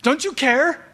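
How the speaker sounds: desperate cry of a believer to God. Retold or imitated. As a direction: plead like a child needing his daddy. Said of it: "We cry out".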